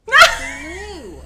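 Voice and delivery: word blurted out loud through laughter; bursting laugh. Real, not acted. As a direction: let the laugh break through it.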